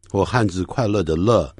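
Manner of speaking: ordinary delivery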